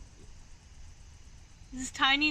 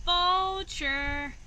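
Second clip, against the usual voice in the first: calling a name, higher and louder; 5 semitones higher, 3 dB louder than usual. Acted. Nerdy Birdie calls for his missing friend, calling out. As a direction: call out across the sky.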